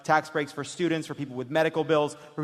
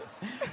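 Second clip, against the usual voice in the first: laugh; 7 semitones higher, 10 dB quieter than usual. Real, not acted. Host laughs at joke.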